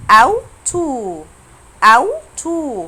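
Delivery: أوتو slow and loud, opening on "ow"; careful model pronunciation. Shown as a demonstration. Teacher asks class to focus on the word, careful pronunciation. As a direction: stretch أوتو out as "OW-too". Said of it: "ركزوا كده في نطقها".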